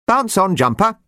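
high, bouncy little chirp; shrill chirpy voice. Acted. Jumper talks to himself while hopping, shrill and chirpy. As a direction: chirp it like a cheerful sprite hopping along. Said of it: "a shrill, chirpy voice that talked to itself".

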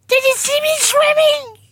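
put-on very high, small child's voice; eager little Roo. Roo asks Eeyore about his swim, very high and eager. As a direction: chirp it up high like a tiny joey.

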